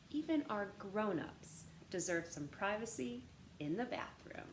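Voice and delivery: usual speaking voice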